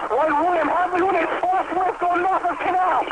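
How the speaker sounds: very high, urgent, breathless call; frantic. Real, not acted. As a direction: call it out in real alarm. Frantic radio call after collapse.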